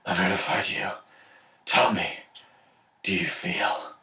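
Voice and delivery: low, hushed, menacing Batman; Batman impression. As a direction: drop low and quiet, like a brooding superhero. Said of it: "and then Batman's like".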